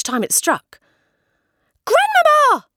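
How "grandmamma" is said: much higher, full-throated call; loud shout. A narrator's reading. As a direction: yell through the door to grandmother. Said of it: "I shouted as loudly as I possibly could".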